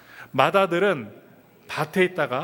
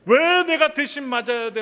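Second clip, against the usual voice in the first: much higher, louder whiny protest; wronged child protest; 9 semitones higher, 4 dB louder than usual. Acted. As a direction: cry out how unfair it is, like a punished eldest. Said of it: "하면 억울해합니다".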